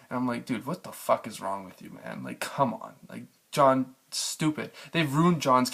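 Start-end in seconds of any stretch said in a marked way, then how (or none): none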